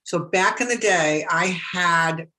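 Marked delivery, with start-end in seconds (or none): none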